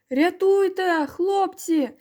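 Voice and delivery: high, wailing plea; yelling for help. A narrator's reading. Head in the lake begs boys for rescue, yelling. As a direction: cry out to be saved, pitched well up. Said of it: "зарепетувала голова".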